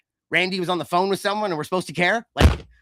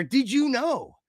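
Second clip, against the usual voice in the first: announcer voice; 7 semitones higher, slower than usual. Retold or imitated.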